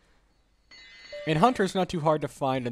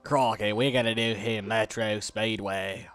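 lightly put-on Australian accent; mock Australian accent; 4 semitones lower than usual. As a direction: play at being a laid-back Aussie. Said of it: "I want that accent".

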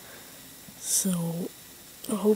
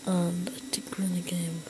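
whispering; 6 dB quieter than usual. Real, not acted. Hiding in game, whispering.

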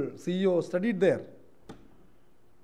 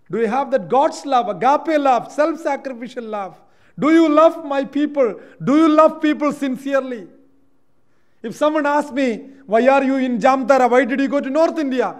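loud, high, urgent sermon voice; full-force preaching; 9 semitones higher, 10 dB louder than usual. Acted. Preacher pressing the congregation on love, at full force.

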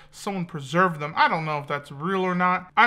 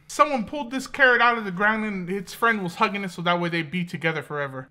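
usual speaking voice

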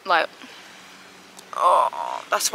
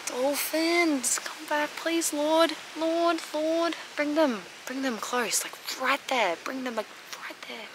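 higher, begging voice; pleading; 8 semitones higher, 4 dB quieter, slower than usual. Real, not acted. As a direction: beg the Lord to bring them closer. Begs the dolphins to return.

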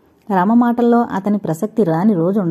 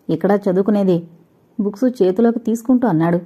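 plain reading voice

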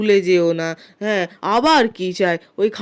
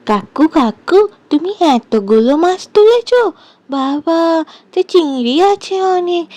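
put-on excited child; 7 semitones higher, 5 dB louder than usual. Girl amazed at uncle's catch.

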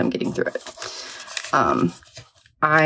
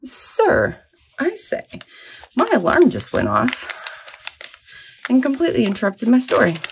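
mock indignation; 8 semitones higher than usual. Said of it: "mock address to alarm".

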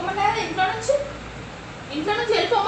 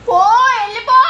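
loud, high yell of go away; angry shout; 7 semitones higher, 9 dB louder than usual. Real, not acted. Child yelling at mother to go away, angry shout. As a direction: shout them off you angrily.

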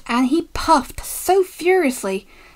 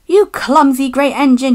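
loud angry outburst; cross, forceful telling-off; storming; 3 semitones higher, 7 dB louder than usual. A narrator's reading. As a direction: storm at the culprit. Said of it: "he stormed".

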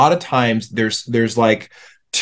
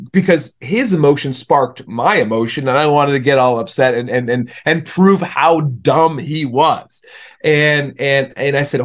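usual speaking voice

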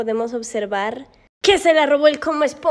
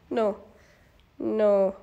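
ordinary delivery

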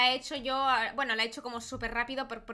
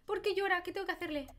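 distressed fussing; 7 semitones higher, 4 dB louder than usual. Real, not acted. Player finds the baby boy crying, high worried fussing. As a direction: fret in a high, helpless voice.

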